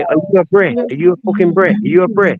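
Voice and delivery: mock confrontation. [name] imitates Dublin fellas confronting him as a boy, mock confrontation.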